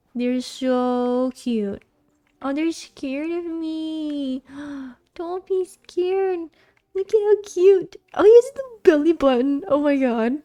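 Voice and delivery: slow, high cooing voice to tiny creatures. Real, not acted. Baby-talking to tiny Jack Frosts.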